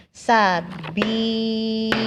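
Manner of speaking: Sabili slowly, bi held long; teacher chants Sabili with a long bi; long vowel. Shown as a demonstration. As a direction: stretch the bi in Sabili. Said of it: "yang dibaca panjang bi".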